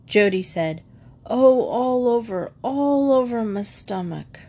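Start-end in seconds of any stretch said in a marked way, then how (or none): 1.2-4.4 higher-pitched whimper of a boy playing sick; groaning complaint.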